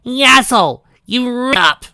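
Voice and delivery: high loud furious roar. Acted. Pinky roaring at Divine. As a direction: roar at him through the bars.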